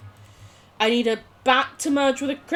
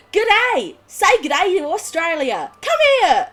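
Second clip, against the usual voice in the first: loud, high, hammed-up Australian accent; terrible Australian accent; 6 semitones higher, 6 dB louder than usual. Streamer imitating Australian adverts, terrible Australian accent. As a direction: holler it like a bad Australian advert. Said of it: "Terrible accent, I know. No judging".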